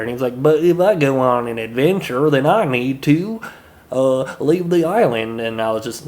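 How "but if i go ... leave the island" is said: slow, louder, higher old-timey Southern drawl, like a cartoon villager. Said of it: "a old-timey Southern drawl".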